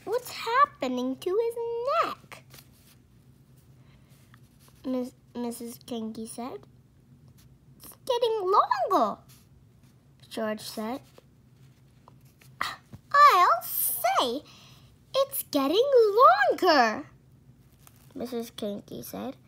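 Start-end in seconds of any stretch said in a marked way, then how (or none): 0.1-2.4 high, startled question; alarmed. Acted. Wife startled at the cockerel's neck, alarmed. 7.8-9.2 high, loud amazement; amazed. Acted. George amazed at the growing neck. 13.1-17.1 high, loud alarmed echo. Acted. Wife echoing George in shock, loud.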